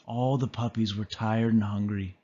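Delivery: usual speaking voice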